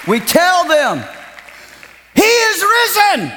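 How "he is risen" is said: high, slow proclamation; ringing proclamation. Real, not acted. Preacher proclaiming the resurrection, high and loud. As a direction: ring it out to the rafters.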